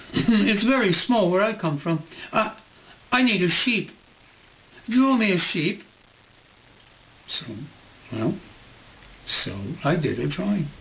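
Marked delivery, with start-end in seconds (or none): 0.1-5.8 put-on higher childlike plea; little prince. Little prince explaining his tiny home, higher childlike voice. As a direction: plead simply like a small boy.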